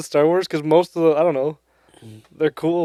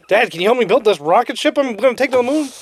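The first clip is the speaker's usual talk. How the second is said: put-on higher eager kid voice with laughter; genius kid voice; 7 semitones higher than usual. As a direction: ask like an excited child. Voicing genius kid asking dad.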